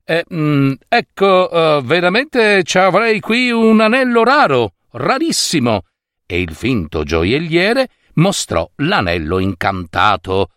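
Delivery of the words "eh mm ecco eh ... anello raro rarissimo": high wheedling merchant voice; sly merchant. A narrator's reading.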